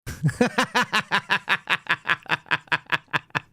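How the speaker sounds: high, breathy burst of laughter; real laughter. Real, not acted. Host cracking up at the post, laughing.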